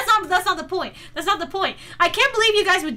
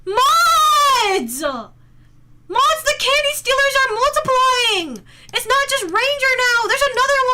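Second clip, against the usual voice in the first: high, loud cry for the moderators; hammed-up panicked shouting; mock alarm; 8 semitones higher, 5 dB louder than usual. Calls moderators on candy stealers.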